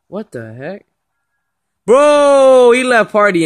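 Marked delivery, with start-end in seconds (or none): 1.9-3.5 loud, high burst of disbelief. Real, not acted. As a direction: shout it out in amazement. Reacting to friend rage quitting.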